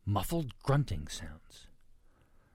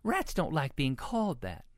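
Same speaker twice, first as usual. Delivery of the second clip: raised-pitch motherly correction; mild scolding; 8 semitones higher than usual. A narrator's reading. Mama pig corrects Baby about rats, mildly scolding. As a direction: chide gently in a higher voice. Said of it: "scolded Mama Sniff".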